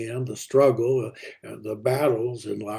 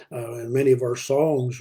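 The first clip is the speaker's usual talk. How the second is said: usual speaking voice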